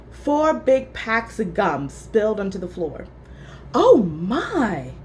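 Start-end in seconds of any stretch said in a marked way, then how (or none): 3.7-4.9 a startled little gasp; surprised. A narrator's reading. Teacher finding gum in her drawer, surprised. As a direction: caught out by the gum. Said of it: "she said".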